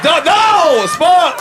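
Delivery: hammed-up outrage; mock scolding.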